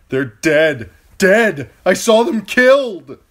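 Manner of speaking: high, loud desperate yell; frantic shout. A narrator's reading. Theon yelling at the dream wolves, frantic shout. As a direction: scream it at the dead wolves. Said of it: "he tried to shout".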